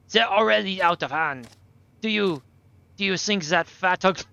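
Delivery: put-on German-accented boy arguing back; captive German boy, indignant. Captive boy says things are out of hand, indignant.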